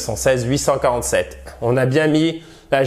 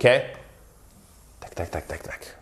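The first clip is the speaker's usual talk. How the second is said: usual speaking voice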